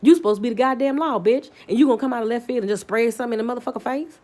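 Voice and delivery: heated, rising tirade at the officer; angry rant. Retold or imitated. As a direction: tear into the cop. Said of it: "I'm like".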